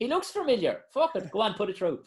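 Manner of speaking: high mock voice giving in, shrugging; dithering official. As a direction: pitch up, then wave it through carelessly. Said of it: "He's like".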